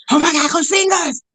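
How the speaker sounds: excited fan. Retold or imitated. Young Autumn running up to her hero, excited shout. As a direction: squeal it like an eager young fan. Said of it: "She goes".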